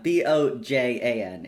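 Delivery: ordinary delivery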